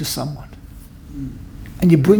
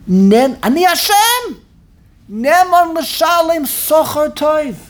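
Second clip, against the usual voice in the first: voicing Hashem; 8 semitones higher than usual. Voicing Hashem offering pay.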